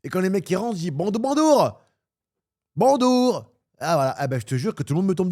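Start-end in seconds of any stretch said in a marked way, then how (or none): 0.9-3.5 put-on high, loud caricatured Chinese-accented greeting; exaggerated Chinese accent, pitched way up; mock Chinese accent. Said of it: "on va ouvrir un truc chinois".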